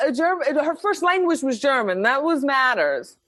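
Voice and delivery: ordinary delivery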